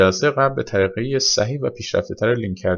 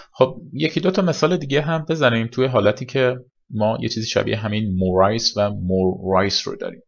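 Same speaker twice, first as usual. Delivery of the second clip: usual speaking voice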